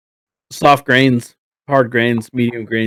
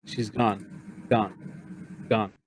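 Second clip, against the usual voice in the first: hushed, soft-voiced horse, lost; horse repeating; 14 dB quieter, slower than usual. Horse tells the party its rider is gone, hushed. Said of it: "keeps kinda saying".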